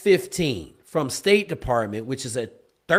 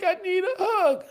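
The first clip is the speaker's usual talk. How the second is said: much higher teary whimper; mock crying. The sobbing candidate answering her interviewer, teary high voice. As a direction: squeak it out like she's still crying. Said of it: "She was like".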